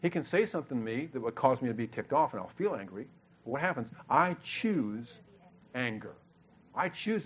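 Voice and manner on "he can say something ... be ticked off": usual speaking voice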